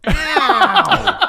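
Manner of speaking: high laughing ow; mock ow. Player reacting to in-game punch, laughing yelp. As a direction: yelp it like a playful ouch.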